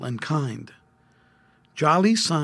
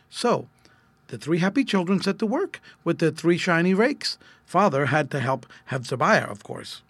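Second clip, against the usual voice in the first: plain reading voice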